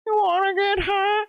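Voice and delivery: a stoned cartoon towel offering; Towelie. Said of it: "It's Towley that goes".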